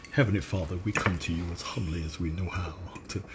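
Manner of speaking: low, soft, solemn grace; mock-serious dinner blessing, hushed and deep; prayer voice. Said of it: "that's my prayer voice".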